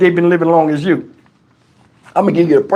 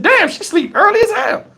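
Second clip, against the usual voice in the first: much higher-pitched, amazed aside; mock astonishment, pitched way up; retold amazement; 13 semitones higher than usual. Boyfriend noticing she's snoring already, high-pitched mock surprise.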